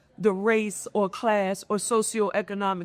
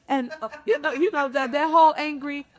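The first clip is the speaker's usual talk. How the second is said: normal talking voice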